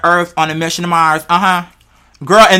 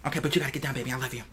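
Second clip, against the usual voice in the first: tender coaxing; 7 semitones lower, 19 dB quieter, much faster than usual. Real, not acted. Coaxing someone to get down.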